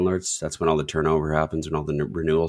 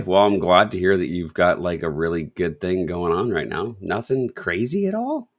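normal talking voice

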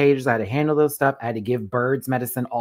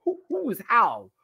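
high indignant ranting; mock angry mom; 8 semitones higher, 3 dB louder than usual. Mom and clerk arguing, high angry retort. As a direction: rant back high and offended. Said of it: "The mom's like".

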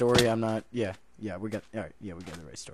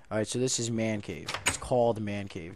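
ordinary talk, nothing marked

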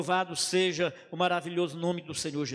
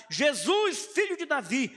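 a raised, pleading cry; crying out loud; 5 semitones higher, 3 dB louder than usual. Retold or imitated. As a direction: call out to Jesus over the crowd. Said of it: "a clamar bem alto, a gritar". (clamor em voz alta)

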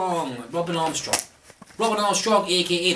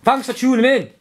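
high, loud cheery call; hammed-up hype greeting; loud sign-off; 8 semitones higher, 7 dB louder than usual. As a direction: burst out with it. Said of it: "I could say something like".

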